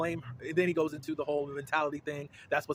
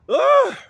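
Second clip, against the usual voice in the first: loud high disgusted ugh; disgusted groan; 14 dB louder than usual. Real, not acted. Disgust at husband.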